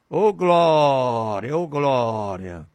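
slow, falling praise; worshipful praise. Real, not acted. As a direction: breathe out glória slowly in worship. Worship exclamation.

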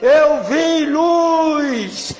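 a weeping stranger shouting that he sees light; retold stranger crying out in awe; crying man shouting. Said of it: "o homem veio gritando, chorando".

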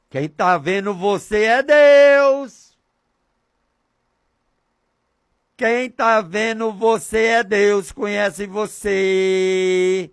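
high, drawn-out proclamation; fervent preaching. Real, not acted. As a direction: proclaim it, holding the last word long. Preaches at full force. (pregação fervorosa com finais arrastados)

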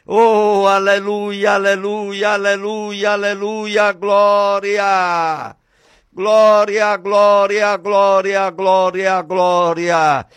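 rapid chain of praise on a held note; fervent chanted preaching cry; ecstatic praise. Real, not acted. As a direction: pour out the praises without a break. Chanted praise at full force.

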